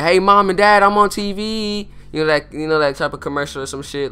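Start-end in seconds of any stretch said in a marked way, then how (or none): 0.0-1.9 loud, then slow, excited call to the folks at home; hammed-up thrilled to be on TV; excited TV shout-out. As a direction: wave at Mom and Dad through the screen. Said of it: "I'd be like".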